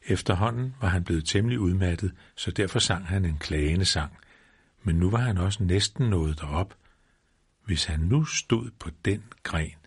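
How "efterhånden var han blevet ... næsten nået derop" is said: ordinary delivery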